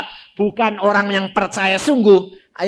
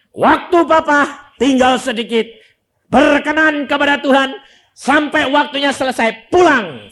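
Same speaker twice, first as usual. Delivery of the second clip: higher, louder solemn proclamation; preaching at full force; 9 semitones higher, 8 dB louder than usual. Acted. Preacher proclaiming the father's peaceful passing, loud and fervent. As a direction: proclaim it like a promise from God.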